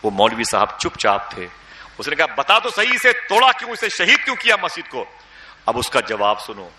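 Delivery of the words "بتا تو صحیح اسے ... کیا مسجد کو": angry demand. Retold or imitated. Villager shouting at the cleric to confess, furious. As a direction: shout the question at the culprit, outraged. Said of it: "بہت ڈانٹا".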